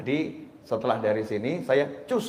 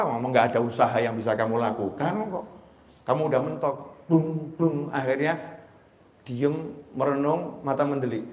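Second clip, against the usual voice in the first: usual speaking voice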